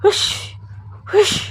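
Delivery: breathy "hush" washing noise; hushing sound. A narrator's reading. Washerman scrubbing clothes, hushing with each stroke. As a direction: puff a sharp hush through the mouth. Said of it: "నోటితో ... అని sound చేస్తున్న".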